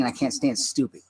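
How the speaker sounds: usual speaking voice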